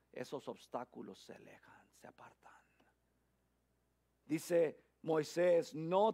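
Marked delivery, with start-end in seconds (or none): 0.1-2.5 breathy preacher's whisper. Acted. Preacher whispering to the congregation that obstacles retreat. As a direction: hush it, let the promise sink in.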